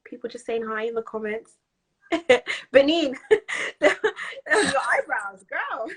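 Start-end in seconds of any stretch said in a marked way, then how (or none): 2.1-5.9 giggling through the words; helpless giggles between words; real laughing. Real, not acted. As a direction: tease a friend, breaking into laughter. Teasing friend about eyebrows, laughing.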